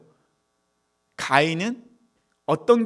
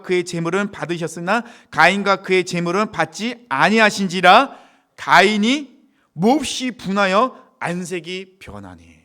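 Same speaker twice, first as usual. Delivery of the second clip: loud, raised declamatory verse reading; preacher reading scripture at full voice; 4 semitones higher, 8 dB louder, slower than usual. Acted. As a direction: proclaim the verse, drawing out the final phrases. Reads the Bible verse aloud.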